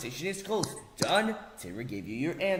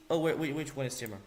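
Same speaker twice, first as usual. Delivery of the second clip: usual speaking voice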